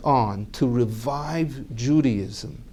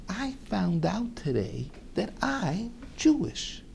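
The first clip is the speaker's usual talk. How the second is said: hushed confession; 7 semitones higher, 10 dB quieter than usual. Retold or imitated. Grave confession to wife.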